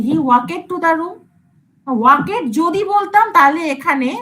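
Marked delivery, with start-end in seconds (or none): 0.1-1.2 he walked to the room said very slowly as a question, walked with an extra vowel before the d; slow rising question on walked stretched to two syllables; questioning wrong form. Shown as a demonstration. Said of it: "আমি কি এরকম বলি".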